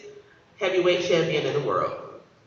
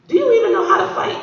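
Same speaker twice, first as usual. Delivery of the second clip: amused cop; 14 semitones higher, 6 dB louder, much faster than usual. Retold or imitated. Cop laughs at the boy.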